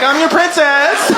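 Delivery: put-on high, drawn-out girly voice; mock little princess. Daughter to dad after locking the door, mock princess. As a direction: sing it out slowly like daddy's little girl. Playing daughter called by girl name.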